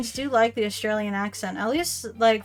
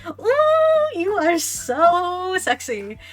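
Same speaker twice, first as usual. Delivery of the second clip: put-on high, slow, swooning old lady; much higher, drawn-out mock swoon; mock swooning grandma; 8 semitones higher, 5 dB louder, much slower than usual. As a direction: coo it like a smitten grandma. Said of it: "And then she's like".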